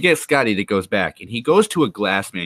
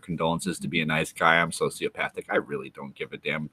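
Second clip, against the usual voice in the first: much softer, lower muttered aside; mock aside; 5 semitones lower, 8 dB quieter than usual. Streamer confesses to chat in a hushed aside. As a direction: say it under your breath as if no one hears. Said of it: "Did I say that out loud?".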